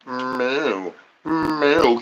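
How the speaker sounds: quiet mock cow moo. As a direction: moo like a sleepy bull. Imitated bull noise.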